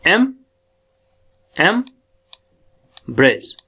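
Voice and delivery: "एम्ब्रेस" very slow with a long gap after em; sounding out syllables. Shown as a demonstration. Teacher introducing the next word, "एम्ब्रेस" pulled apart slowly. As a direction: hold "एम" apart from the rest. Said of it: "ये हमारी sound जो है".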